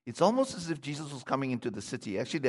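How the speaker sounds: ordinary talk, nothing marked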